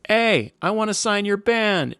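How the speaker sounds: high, drawn-out call from a car window; retold yell. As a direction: mimic hollering to someone down the street. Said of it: "he yells to him".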